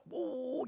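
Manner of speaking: high, drawn-out booo; imitated wave whoosh. Wave overtakes the ship, high imitated whoosh. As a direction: sweep a long high ooh like surging water.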